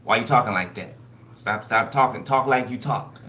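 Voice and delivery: loud scolding spirit voice; loud mock telling-off. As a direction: call her out loudly to stop. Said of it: "Spirit was like".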